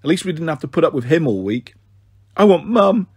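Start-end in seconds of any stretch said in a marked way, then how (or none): 2.4-3.1 wailing. Acted. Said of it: "Pixie wailed on the toilet".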